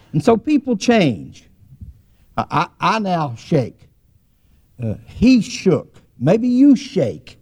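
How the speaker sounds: high, rising preacher's delivery; emphatic preaching. Real, not acted. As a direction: drive the point home, pitched high and stretched. Drives home the sermon point.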